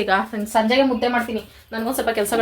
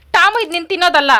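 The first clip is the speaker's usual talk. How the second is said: talking to pet; 7 semitones higher than usual. Real, not acted. Scolding dog playfully.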